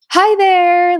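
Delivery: high, drawn-out hello in imitation of a doctor; mock chirpy small-talk greeting. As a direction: put on an overly friendly hi. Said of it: "didn't like how she approached this".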